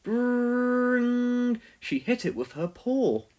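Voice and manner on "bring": a high, louder imitated phone ring. A narrator's reading. The phone rings while Pilchard sleeps, an imitated ring. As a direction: ring it out like an old telephone.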